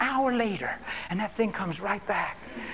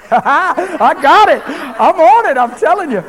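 laughing, loud, high-pitched outburst; exultant shouting; 9 semitones higher, 15 dB louder, slower than usual. Real, not acted. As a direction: crow it out to the crowd, drawn out.

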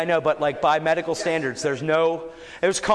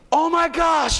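astonished exclaim; 13 semitones higher, 5 dB louder, slower than usual. Retold or imitated. Man feels liver healed.

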